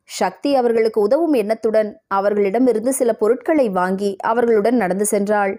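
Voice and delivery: ordinary delivery, nothing marked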